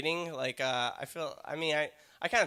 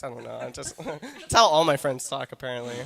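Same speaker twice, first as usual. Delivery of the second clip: ordinary talk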